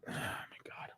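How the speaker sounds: whispered, no voice at all; real whispered despair. Real, not acted. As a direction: breathe it out in defeat. Fan despairing at the result.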